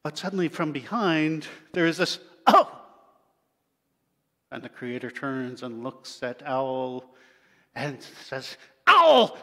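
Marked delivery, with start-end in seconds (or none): 2.4-2.8 high, loud shocked "Oh!"; a sudden yelp of surprise from behind; owl's startled cry. Acted. Owl cries out in shock. 8.8-9.4 loud, high, sharp call of "Owl!"; shouting the owl's name. Acted. Creator catches owl peeking.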